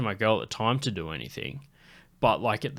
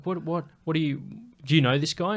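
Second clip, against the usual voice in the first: higher-pitched imitation of an Irishwoman; mock Irish challenge, pitched up; thick Irish accent; 5 semitones higher than usual. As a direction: put on an Irish accent, questioning. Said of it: "with this fucking thick Irish accent".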